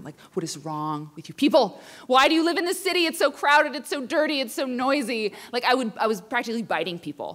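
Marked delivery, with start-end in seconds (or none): 0.3-5.3 loud, high exasperated rant; higher, louder furious complaining. Retold or imitated. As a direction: rage at the whole city. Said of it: "furious all the time".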